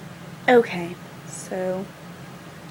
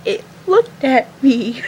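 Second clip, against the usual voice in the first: loud, slow, whiny sob; mock crying; 2 semitones higher, 8 dB louder, slower than usual. Friend crying over the raccoon, loud mock sobbing. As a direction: wail it like a hammed-up crybaby. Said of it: "she starts crying and says".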